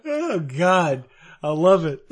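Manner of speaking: ordinary delivery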